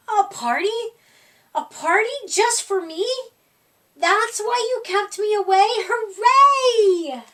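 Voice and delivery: building joy up to a cheer; excited. A narrator's reading. Seymour discovers his surprise party.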